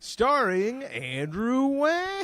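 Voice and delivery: high, slow, stretched announcer parody; spoof show announcer. Pretend. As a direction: draw out the star's billing.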